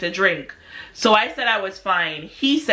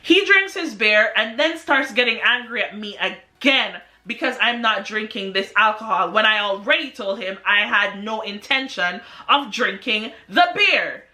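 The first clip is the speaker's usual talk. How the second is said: ordinary talk, nothing marked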